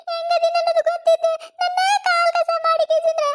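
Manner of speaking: high, tearful wail to God; crying lament. Acted. Abandoned daughter-in-law weeps to mother-in-law, high tearful wail. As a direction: sob out the complaint, voice pitched high. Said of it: "ಅಳಬೇಡವ ನೀನು".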